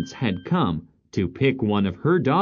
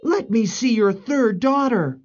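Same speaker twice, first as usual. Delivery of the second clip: higher-pitched, firm royal order; angry prince; 12 semitones higher, 3 dB louder than usual. Acted. Prince stopping the stepmother, angry. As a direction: cut in sternly as the prince. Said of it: "stopped her angrily".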